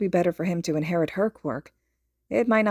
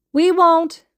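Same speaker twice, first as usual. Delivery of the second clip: put-on much higher, louder child promise; eager reassurance; 11 semitones higher, 10 dB louder than usual. A narrator's reading. Little boy promises the mother they'll behave. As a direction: chirp it back eagerly. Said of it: "Izuku reassures her".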